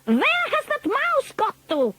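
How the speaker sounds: high, irritated witch voice; Grand High Witch. A narrator's reading. Grand High Witch searching the platform, irritated. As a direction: demand it sharply.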